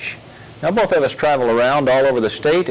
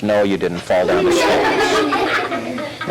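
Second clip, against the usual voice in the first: slow, drawn-out correction; 9 semitones lower, much slower than usual. Acted. Officer corrects the dummy, slow patient correction. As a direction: spell it out slowly.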